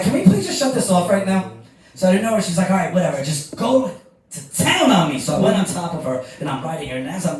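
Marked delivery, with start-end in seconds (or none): 0.0-1.5 high, loud mock begging; exasperated plea. Retold plea over intrusive ad. 2.7-5.2 impatient urging. Acted. Retold partner urging him on.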